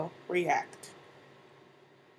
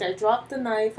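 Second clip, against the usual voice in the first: plain reading voice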